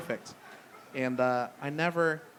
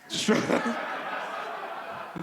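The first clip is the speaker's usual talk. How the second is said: breathy laugh; 7 semitones higher than usual. Real, not acted. Laughs at own drug joke.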